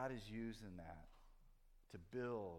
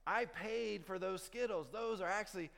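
higher and louder, slowing down on the last lines; indignant rant; 9 semitones higher, 7 dB louder, slower than usual. Retold or imitated. Dad arguing with himself over the candy, rising indignant and slow. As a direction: build up to a righteous, self-important slow claim.

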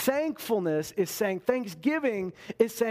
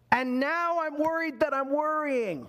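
mock fretful worrier; 8 semitones higher, slower than usual. Mocking a worrier's spiral.

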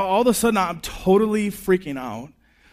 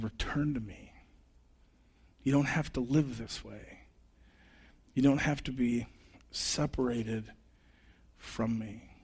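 much softer, gentle pleading voice; God calling to the lost, soft tender plea; 4 semitones lower, 10 dB quieter than usual. Acted. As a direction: call them home gently.

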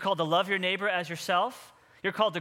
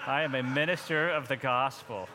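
a little more passion, lightly; 5 semitones lower than usual. Shown as a demonstration. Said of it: "say it with a little more passion".

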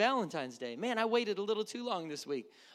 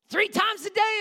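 higher, louder "Three times a day" with a rise on "day"; loud cry; 10 semitones higher, 9 dB louder than usual. Shown as a demonstration. Daniel's defiant prayer to God, "Three times a day" cried out. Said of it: "repeated phrase louder".

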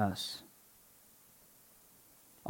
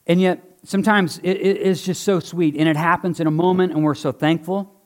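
ordinary talk, nothing marked

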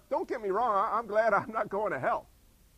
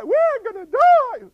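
mock panic; 13 semitones higher, 14 dB louder, slower than usual. Mocking the panicked crew.

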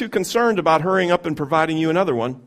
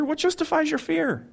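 ordinary talk

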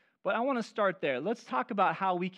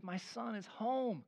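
1 tender joyful father; 3 semitones higher, 9 dB quieter, slower than usual. Retold or imitated. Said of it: "He says".

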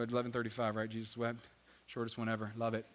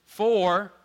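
loud cue; 2 semitones higher, 10 dB louder than usual. Shown as a demonstration. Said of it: "let's try it again".

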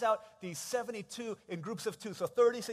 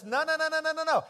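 high, loud run of refusals; emphatic correction; 7 semitones higher, 8 dB louder than usual. Acted. Jesus correcting what people think he says, loud and insistent. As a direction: cut them off insistently. Acting Jesus cutting off wrong guesses.

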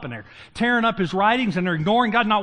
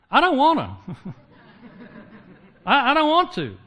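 mock whiny refusal; 6 semitones higher, 4 dB louder than usual. Moses' real excuse, mock whine.

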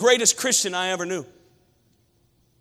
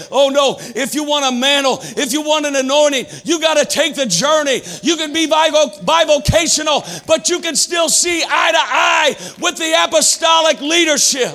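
louder and higher, building to shouts; fiery preaching; 6 semitones higher, 4 dB louder than usual. Acted. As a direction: preach it with full fire. Preaching at full force.

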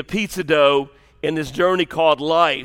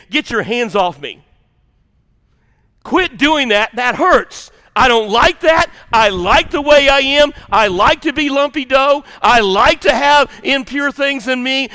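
higher, louder whiny protest as stubborn dough; defiant pizza dough; 5 semitones higher, 5 dB louder than usual. Pretend. Preacher voicing the congregation as defiant dough, whiny protest. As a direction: pout and push back like dough that refuses to change. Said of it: "you would be saying".

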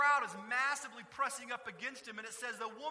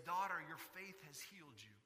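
soft, lower, gentle words; tender blessing; 4 semitones lower, 6 dB quieter than usual. Retold or imitated. Jesus speaking tenderly to the healed woman.

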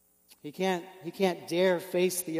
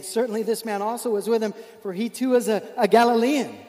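louder, rising accusation; insistent accuser; 4 semitones higher, 6 dB louder than usual. Acted. Bystander insists Peter is a Galilean, building louder.

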